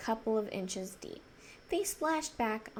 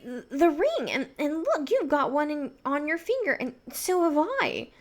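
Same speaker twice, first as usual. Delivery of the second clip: stammering excitement; 4 semitones higher, 7 dB louder than usual. Acted. Didgery excitedly stammering about rings.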